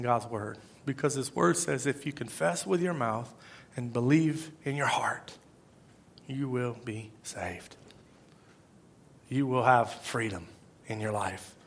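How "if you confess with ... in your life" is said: low, hushed, slow promise; soft and slow. Real, not acted. Preacher promises freedom to the congregation, soft and slow. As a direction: let it drop softly, word by word.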